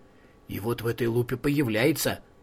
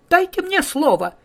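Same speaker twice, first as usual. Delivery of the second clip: squeaky little flea voice, much higher; tiny high voice; 14 semitones higher, 4 dB louder than usual. Acted. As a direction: pipe up in a tiny helium voice. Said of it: "тоненький голосок её слышен".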